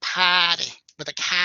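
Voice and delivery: loud mock Boston accent. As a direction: imitate Boston speech with dropped Rs. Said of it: "in Boston, it's that".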